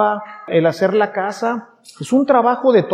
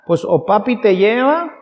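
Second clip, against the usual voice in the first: ordinary talk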